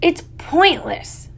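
high, slow and drawn out; exasperated dismissal. Acted. Boy objecting to the search in the woods, exasperated. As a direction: stretch the words in weary protest.

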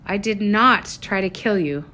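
usual speaking voice

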